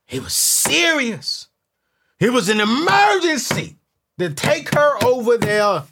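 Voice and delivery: high, loud, slow build; hyped emphasis. Real, not acted. To the audience about Red Lobster, loud hype. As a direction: drag it out big for laughs. Hyping the emergency.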